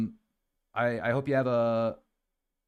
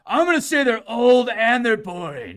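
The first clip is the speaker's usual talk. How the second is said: mocking commenter; 9 semitones higher, 10 dB louder than usual. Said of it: "he's like".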